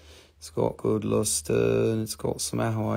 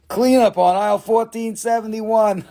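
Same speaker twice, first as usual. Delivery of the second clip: laughing announcement; 10 semitones higher, 7 dB louder than usual. Real, not acted. Joke store announcement through laughter.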